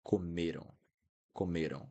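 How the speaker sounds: comeram ending in a nasal "ow", falling; open nasal ending. Shown as a demonstration. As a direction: keep the mouth open at the end of comeram. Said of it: "I'm keeping my mouth opened".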